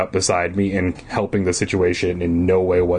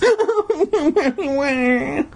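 high, drawn-out fake sob; hammed-up wailing sob; mock crying wail. Shown as a demonstration. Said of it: "That's how I sound when I cry".

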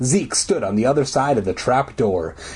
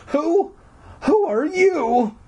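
very high, slow, timid question; frightened stammer; slower than usual. A narrator's reading. Girl confronts a strange little man, frightened high stammer. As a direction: falter on it in a high voice. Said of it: "I stammered".

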